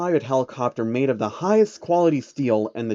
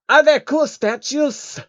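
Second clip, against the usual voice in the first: very high, slow excited creature voice; childlike alien hoping for statues; eager changeling; 14 semitones higher, 5 dB louder, slower than usual. Acted.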